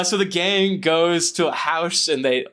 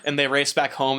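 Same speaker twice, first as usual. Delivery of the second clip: ordinary talk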